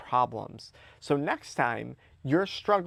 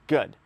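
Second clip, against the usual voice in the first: "Good" punched louder, with a falling tune; emphatic "Good"; 6 dB louder than usual. Acted. To himself facing a headache and busy day, emphatic "Good". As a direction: hit "Good" hard and let it drop. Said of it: "emphasis on the word good".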